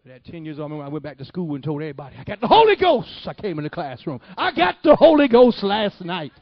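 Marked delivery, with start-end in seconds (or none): 2.2-3.3 high, loud retold yell; excited announcement. Ten-year-old telling everybody at school, excited yell. 4.4-6.3 loud, high, drawn-out proclamation; excited announcement. Retold or imitated. Ten-year-old bursting into the classroom, excited yell.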